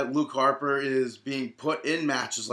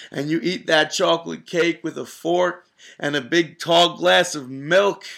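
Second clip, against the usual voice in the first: wrestling promo; 3 semitones higher, 5 dB louder than usual. Pretend. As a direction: ham it up like a wrestler cutting a promo. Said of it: "cut a little promo for us real quick".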